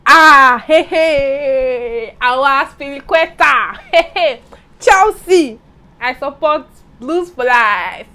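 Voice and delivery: loud, high football fan cheering. Real, not acted. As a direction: shout it like a fan on the terraces.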